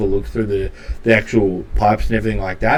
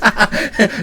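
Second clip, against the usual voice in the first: high-pitched playful cackle; character's giggle; 11 semitones higher, 3 dB louder than usual. Acted. Shopkeeper after handing over a trick nut, high cackle. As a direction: cackle as the excitable inventor. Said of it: "And he goes".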